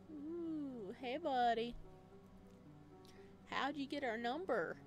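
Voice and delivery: normal talking voice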